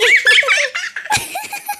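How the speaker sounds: high, sly giggle; mocking laugh. Teasing giggle after fooling the friend. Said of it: "तुम हंस रही हो?".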